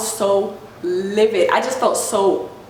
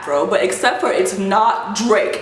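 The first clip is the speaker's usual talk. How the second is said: usual speaking voice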